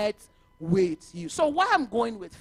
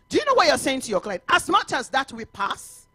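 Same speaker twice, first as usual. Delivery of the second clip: usual speaking voice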